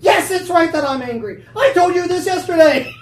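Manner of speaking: high, very loud indignant shouting; angry Jonah. Acted. Jonah answering God's question, furious shout. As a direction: shout it back, outraged. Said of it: "is it right that you're angry".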